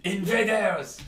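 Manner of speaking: loud, high hammed-up cry; mock alarmed shout. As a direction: shout a comic alarm, drawn out. Game master joins mock French outburst.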